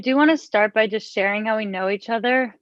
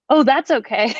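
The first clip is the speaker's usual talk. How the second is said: a touch brighter and louder, oddly pleased at bad news; oddly happy; 3 semitones higher, 4 dB louder than usual. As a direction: sound pleased that he can't do the movie. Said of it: "I said it like I was happy".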